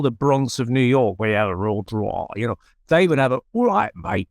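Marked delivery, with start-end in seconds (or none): 3.5-4.3 higher-pitched Cockney greeting; a quick taste of East London Cockney; London Cockney accent. Shown as a demonstration. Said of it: "kind of London accent".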